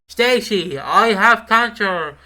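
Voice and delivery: loud, drawn-out mock soap line; shouting over noise. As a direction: call it out over a roaring washing machine. Acting line over noisy washing machine.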